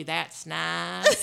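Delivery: "nice" drawn out as "nahs"; Southern put-down. Shown as a demonstration. Southern woman telling someone off politely, "nice" drawled as "nahs". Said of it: "a woman from the South is telling you".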